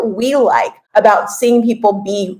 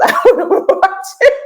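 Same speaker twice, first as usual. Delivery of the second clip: laughing through the words; 14 semitones higher than usual. Real, not acted. Laughing while quoting herself.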